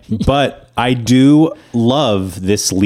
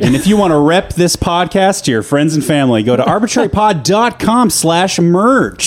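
upbeat ad read, higher pitch; commercial voice; 7 semitones higher than usual. Acted. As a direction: sell it like a radio ad. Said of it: "It's my, it's my commercial voice".

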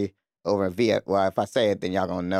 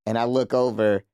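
usual speaking voice

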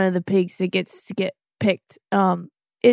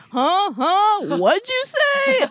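high mock confusion; 11 semitones higher, slower than usual. Mock hard of hearing.

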